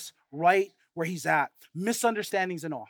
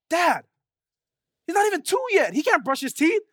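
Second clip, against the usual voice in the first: lightly voiced young girl, much higher; daughter's voice; 8 semitones higher, 3 dB louder than usual. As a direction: pitch up to play his daughter. Daughter voiced correcting dad.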